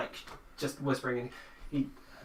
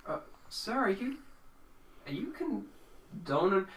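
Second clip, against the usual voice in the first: high, halting question; nervous underling questioning a superior; hesitant underling; 8 semitones higher than usual. Acted. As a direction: stammer it timidly, pitched well up. Game master voices hesitant underling.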